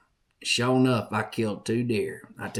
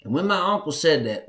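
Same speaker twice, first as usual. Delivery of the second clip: ordinary delivery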